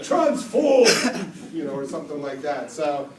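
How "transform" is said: loud laughing shout, pitched high; shouted Transform. Pretend. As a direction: bellow it with a laugh like a fan. Imagined fan shouting catchphrase.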